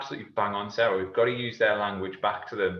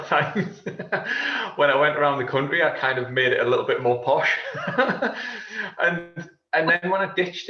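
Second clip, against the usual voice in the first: words with a real laugh through them; amused, laughing aside; laughing while talking; 3 semitones higher, 3 dB louder than usual. Real, not acted. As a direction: chuckle through the confession. Laughing at his own accent story.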